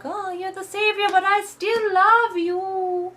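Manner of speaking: very high, slow hammed-up lovesick voice; mock swooning boyfriend. Tobias waking up to Layla, high slow mock swoon. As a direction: swoon over her like a cheesy hero. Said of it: "be like".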